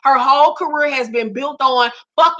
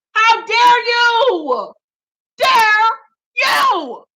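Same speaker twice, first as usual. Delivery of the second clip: furious outburst; 8 semitones higher, much slower than usual. Real, not acted. Defending [name] against [name], furious drawn-out shout. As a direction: stretch every word in outrage.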